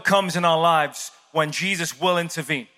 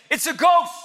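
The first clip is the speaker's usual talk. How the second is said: high-pitched mock panic; panicked disciples crying out in alarm; freaking out; 10 semitones higher, 3 dB louder than usual. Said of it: "the disciples are freaking".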